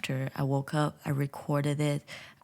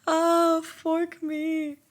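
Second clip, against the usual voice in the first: high, loud mock outrage; a high comic cry of horror; shocked yelp; 13 semitones higher, 3 dB louder, much slower than usual. Real, not acted. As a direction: squeal at the price tag. Reacting to cat tree price.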